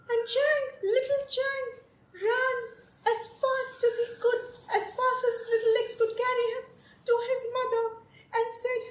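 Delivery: high, breathless telling; urgent narration. A narrator's reading. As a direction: tell it in a rush, high and anxious. Tense narration of the run.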